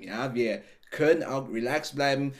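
normal talking voice

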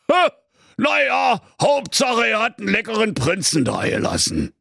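high, odd dragon voice, pleased; a greedy dragon musing over its meal. Acted. Dragon finding a prince at the door, high and gleeful. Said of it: "Der Drache sprach".

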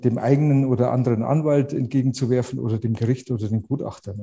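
ordinary delivery

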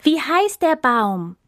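high, slow cry from the hungry animals; hungry animals calling to the trapped elephant. Acted. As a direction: call out eagerly, stretched.

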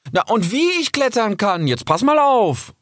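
high, spirited fox voice; cocky, high-pitched challenge; eager boast. Acted. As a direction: show off with rising excitement. Fox boasts before climbing.

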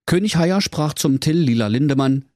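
ordinary delivery

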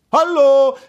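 loud, high call. A narrator's reading. Prince calling into the deserted gorge, loud call. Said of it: "rief der Prinz".

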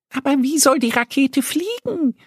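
very high little duck voice, puzzled; Annie asking. Acted. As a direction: ask like a curious duckling. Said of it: "fragt Annie".

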